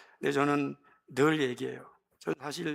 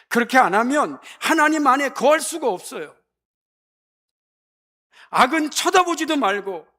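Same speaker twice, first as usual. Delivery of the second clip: higher and louder, urgent exhortation; fiery preaching; 9 semitones higher, 8 dB louder than usual. Acted. Preacher urging youth to give themselves to God, fiery. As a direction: hammer the call to commitment home.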